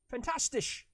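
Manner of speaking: Fantastisch burst out loud and high; proud, loud, high. Real, not acted. Streamer finally saying a German word right, proud shout. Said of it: "I can say that properly".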